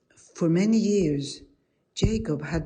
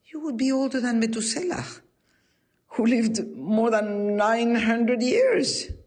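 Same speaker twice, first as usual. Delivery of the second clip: acted disbelief, pitched up; raised-pitch astonishment at an impossible age; incredulous rabbi; 5 semitones higher than usual. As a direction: challenge her claim in a higher storytelling voice. Rabbi doubts her impossible age.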